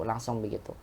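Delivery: normal talking voice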